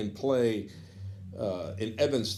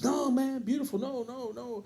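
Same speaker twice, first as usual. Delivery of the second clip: Moody's eager reply; 13 semitones higher than usual. Retold or imitated. Jazz great happily agrees to questions, much higher and fading. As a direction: jump way up, warmly welcoming, then trail off. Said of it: "Moody, would you mind if I ask you some questions?".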